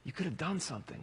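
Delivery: ordinary delivery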